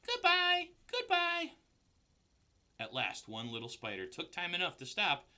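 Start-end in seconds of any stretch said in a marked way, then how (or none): 0.0-1.5 very high squeaky goodbyes; tiny voices calling. A narrator's reading. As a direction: spiders calling back as they drift away. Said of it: "they called".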